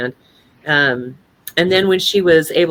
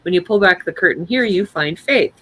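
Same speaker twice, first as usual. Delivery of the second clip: usual speaking voice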